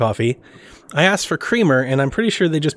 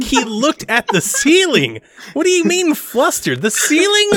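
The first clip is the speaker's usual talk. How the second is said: rising higher and higher in disbelief; mock disbelief, slow then pitched way up; incredulous; 12 semitones higher, slower than usual. Father retelling to his wife his disbelief about the ceiling. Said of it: "I was like".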